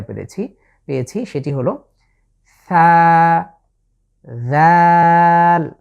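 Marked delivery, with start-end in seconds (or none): 2.7-5.7 light, thin জাল held long; light Arabic letters. Shown as a demonstration. Teacher reviewing light letters with viewers, thin drawn-out সা, জাল. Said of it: "পাতলা করে উচ্চারণের চেষ্টা করতে হবে".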